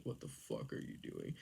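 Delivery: low, very soft husky voice; mock menacing man. Man reacting to being tickled, mock menace. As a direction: imitate a brooding man under the breath.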